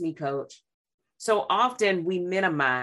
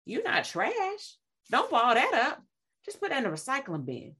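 Christ reassuring; 5 semitones higher, 3 dB quieter than usual. Retold or imitated. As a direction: soothe someone who thinks they are trash. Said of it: "And Christ says".

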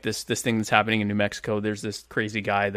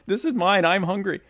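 greedy squatter; 8 semitones higher than usual. Retold or imitated. Voicing greedy communist.

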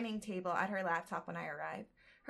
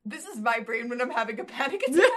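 laughing, much louder and fast; 6 semitones higher, 11 dB louder, faster than usual. Real, not acted. Reader laughing at herself with her co-host. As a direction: crack up while admitting it.

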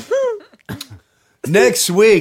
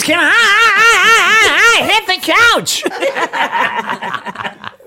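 high, halting, very slow stammer; high stammering drunk; slower than usual. Acted. Voicing stammering [name].

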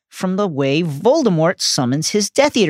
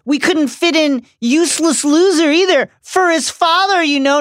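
much higher, lightly voiced mocking bully; sneering Draco; 9 semitones higher than usual. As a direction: taunt like a smug schoolboy.

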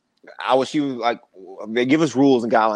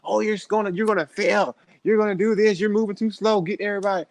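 mock yelling instructors; 9 semitones higher than usual. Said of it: "they're yelling at us the whole time".

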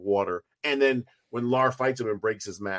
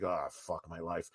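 much softer, slow, deflated mutter; defeated groan; 7 semitones lower, 14 dB quieter, slower than usual. Retold or imitated. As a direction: let it sink out of you, beaten.

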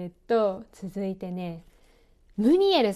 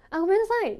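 high, louder automatic sorry; imitating your own knee-jerk sorry; reflexive apology; 11 semitones higher, 5 dB louder, faster than usual. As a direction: blurt a light, habitual apology. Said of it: "そこまで謝罪の意思はないんだけど".

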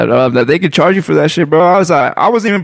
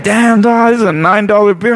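higher-pitched shock at a price; retold dismay; 6 semitones higher than usual. Drinker reacting to being charged for a sample, dismayed. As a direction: exclaim in mild disbelief. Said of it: "Bro, I was like".

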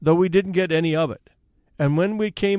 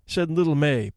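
plain reading voice

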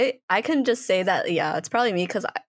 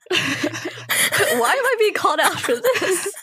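laughing; 10 semitones higher, much slower than usual. Real, not acted. Called out, laughing.

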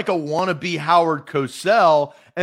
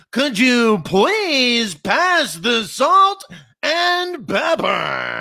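mock Adam Schein impression; 6 semitones higher, much slower than usual. Imagining the host asking his wife at home, hammed-up impression. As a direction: ham it up like a stilted TV host at dinner. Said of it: "do you say".

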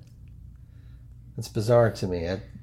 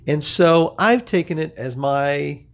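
slow, stretched-out show opening; podcast intro announcer; 3 semitones higher, 3 dB louder, slower than usual. Acted. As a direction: address all listeners in a measured host delivery.

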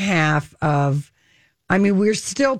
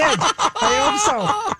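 laughing shout; 12 semitones higher, much slower than usual. Real, not acted.

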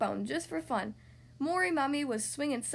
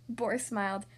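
usual speaking voice

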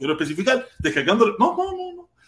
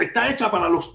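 normal talking voice

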